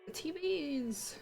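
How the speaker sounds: ordinary delivery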